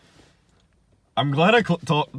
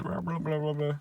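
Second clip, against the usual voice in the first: mock mumble; 4 semitones higher, 7 dB quieter than usual. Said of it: "he mumbles some answer".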